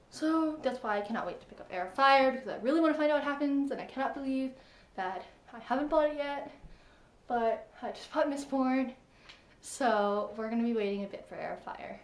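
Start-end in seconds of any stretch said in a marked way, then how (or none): none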